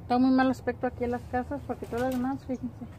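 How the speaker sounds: usual speaking voice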